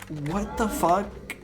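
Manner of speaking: mock outrage. Players mocking the developer's choice with indignant outrage. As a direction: play the outraged forum crowd.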